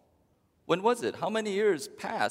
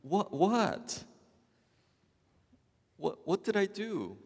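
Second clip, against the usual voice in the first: higher, baffled husband voice; clueless husband; 7 semitones higher, slower than usual. Acted. Husband protests to upset wife, baffled and higher. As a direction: play the confused husband asking what he did. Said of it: "The husband says".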